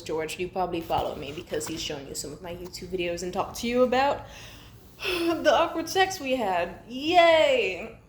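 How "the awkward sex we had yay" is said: sighing mockery. Real, not acted. Mocking sigh about ex.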